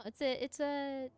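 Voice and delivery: ordinary talk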